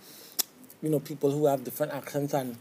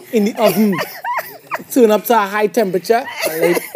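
laughing; 7 semitones higher, 10 dB louder than usual. Real, not acted. Laughing describing recipe.

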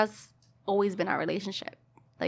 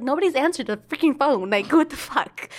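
retold exasperation; 6 semitones higher, 3 dB louder than usual. Retold frantic complaint to her mother.

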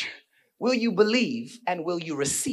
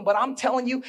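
ordinary talk

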